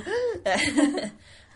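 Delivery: high amused laugh; burst of laughter. Real, not acted. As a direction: laugh out loud at the tease. Laughing at a friend's teasing.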